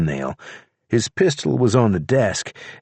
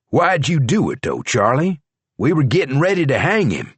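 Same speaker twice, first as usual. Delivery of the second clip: higher, puzzled question; sheriff's voice; 7 semitones higher than usual. A narrator's reading. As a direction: ask it, mildly baffled. Sheriff asks Charlie.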